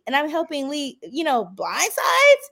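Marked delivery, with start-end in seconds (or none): 1.5-2.5 high, loud gleeful boast; triumphant brag. Real, not acted. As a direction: crow about it, proud. Bragging about leading blindsides.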